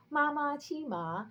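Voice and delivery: "Ma ma qi ma" slow, high and level, dipping on the last word; tone tongue twister. Shown as a demonstration. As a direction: exaggerate each tone in "Ma ma qi ma". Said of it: "with different intonations".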